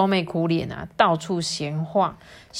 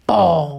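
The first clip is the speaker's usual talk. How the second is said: sudden loud spoken crash; loud thud imitation; 8 dB louder than usual. A narrator's reading. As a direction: burst out one booming "bang". Sound effect of pig falling. (大声模仿摔倒的撞击声)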